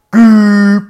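loud, high cartoon gushing noise; imitated plop noise. Storyteller acting out sudden toilet release, comic noise. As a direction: blurt a drawn-out comic bodily sound effect. Imitates the one-minute shit.

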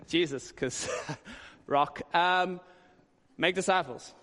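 a hint of Peter, chuckling through it; half-laugh the words in disbelief. Peter reacting to Jesus' commission, laughing in disbelief. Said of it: "I can imagine Peter going".

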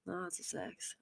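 breathy secretive whisper of Lots of sex. Real, not acted. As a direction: drop into a naughty whisper. Repeats phrase then whispers.

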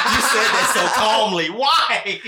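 high, loud laughing question; howling with laughter; laughing disbelief. Real, not acted. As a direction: crack up asking why. Cracking up at friend's answer.

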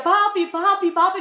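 high, loud retold cry of papi, papi; calling out for dad. Child hearing the door lock rattle calls her father, high and loud. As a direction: shout for your father, frightened, from your bed.

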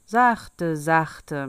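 loud, cheeky rebuff, easing off on the repeat; cocky check. A narrator's reading. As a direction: rein in a boaster, loudly. Boy checks the boasting monster. (keckes, lautes Abwiegeln)

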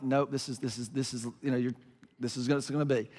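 low, very soft stammering; faltering reassurance. Retold or imitated. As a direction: mumble quietly through a squeamish non-answer. Acts squeamish dad answering daughter.

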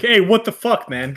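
high, loud acted protest; hammed-up indignant outburst; mock outrage. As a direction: exclaim it like an indignant bystander. Acts out bystanders' outraged reaction.

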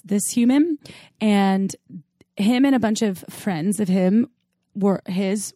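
ordinary delivery

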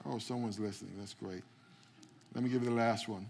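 normal talking voice

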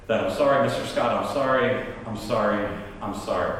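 low, repeated apologies sinking away; imitating sheepish kids; meek apology. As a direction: apologise contritely, voice dropping. Children apologising to angry dad.

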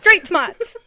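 very loud, high catchphrase impression; John Mulaney. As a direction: shout it like a stand-up comedian. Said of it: "classic John Mulaney line to warm up".

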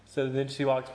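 ordinary talk, nothing marked

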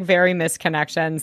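ordinary talk, nothing marked

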